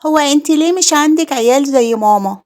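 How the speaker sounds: put-on high, innocent little boy voice; innocent child. Little boy asking his aunt innocently, high child voice. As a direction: ask it like a naive small child. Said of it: "وقال بكل براءة".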